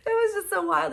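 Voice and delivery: high, laughing exclamation; giddy laugh. Real, not acted. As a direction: laugh through it. Laughing recalling ecstasy.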